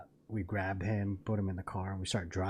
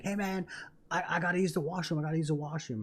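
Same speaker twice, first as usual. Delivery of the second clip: high, insistent retold plea; urgent pleading; 6 semitones higher than usual. Desperate customer at gas station window, urgent pleading. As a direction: imitate begging in a hurry.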